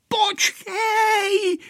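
put-on very high, wailing child's shout; panicked cry. A narrator's reading. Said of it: "vykřikl Pepíček na Vojtu". (vyděšený dětský výkřik)

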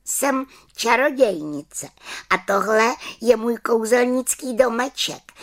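high little witch voice, slowed. Acted. Little witch telling the children who she is, high. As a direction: announce it proudly as the witch.

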